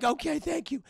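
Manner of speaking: tearful thanks. Retold or imitated. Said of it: "Don't cry".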